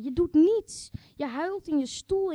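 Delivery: sharp rising rebuke; reproach. Acted. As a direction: call out their doing nothing. Reproach to partner.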